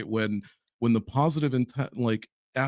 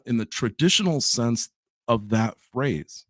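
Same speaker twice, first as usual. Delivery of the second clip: usual speaking voice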